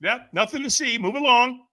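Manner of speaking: high, loud breezy brush-off; mock nothing-to-see voice; fake cheerful denial. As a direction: insist you're fine, too brightly. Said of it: "what do we say?".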